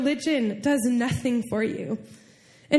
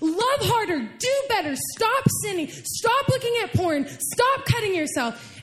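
high-pitched, emphatic string of self-commands; self-berating; 9 semitones higher than usual. Acted. Believer beating themselves up over failings, acted self-berating. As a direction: act out scolding yourself, pitched well up. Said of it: "you're beating your heart and you're saying".

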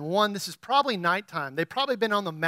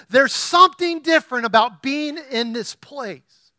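much higher, loud preaching; full-force preaching; 6 semitones higher, 8 dB louder than usual. Acted. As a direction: preach it out, rising and pressing.

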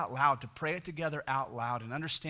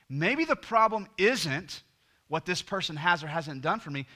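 preaching at full force; 5 semitones higher, 8 dB louder than usual. Real, not acted. Pastor driving home the unpayable debt, full-force preaching. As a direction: pound each phrase home to the church.

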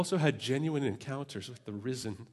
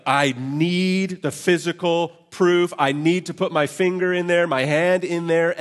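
higher, louder, slow insistent demand; demanding Thomas; 6 semitones higher, 10 dB louder than usual. Acted. Thomas demanding physical proof from the disciples. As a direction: insist on proof, stubborn and loud. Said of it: "he's like".

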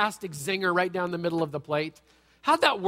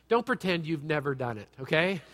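ordinary talk, nothing marked

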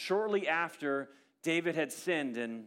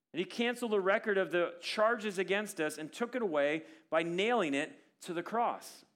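normal talking voice

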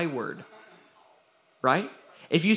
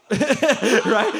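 louder laughing right; real laugh, higher and louder; 7 semitones higher, 9 dB louder than usual. Real, not acted. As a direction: laugh through the word. Laughing after walking away story.

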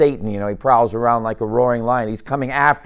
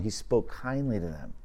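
much softer, dwelling on kindly; soft and tender; 4 semitones lower, 11 dB quieter than usual. Shown as a demonstration. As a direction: hush it and linger on kindly, letting the verse sink in.

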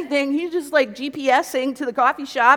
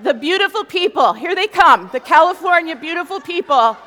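loud, high, gushing announcement; mock fanfare; 5 semitones higher, 10 dB louder than usual. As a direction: hail their arrival with overblown awe. Mock fanfare for arriving friends.

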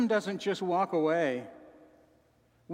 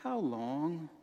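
soft sad sigh; 2 semitones lower, 6 dB quieter than usual. Shown as a demonstration. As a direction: breathe it out like a quiet lament. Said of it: "I just heard the expression softly in my head".

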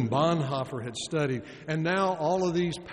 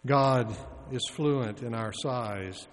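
ordinary talk

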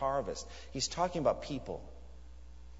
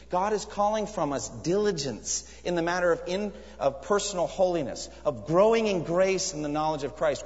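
raised, driving sermon voice; fervent preaching; 5 semitones higher, 3 dB louder than usual. Acted. Preacher urging the congregation to diligence, fervently. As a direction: preach it with urgency.

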